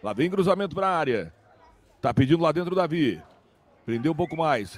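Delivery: normal talking voice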